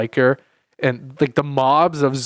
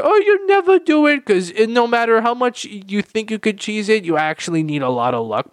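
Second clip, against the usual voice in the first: high whiny mocking voice; mocking Chris; 7 semitones higher than usual. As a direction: mimic him in a whiny high tone. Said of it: "And Chris is like".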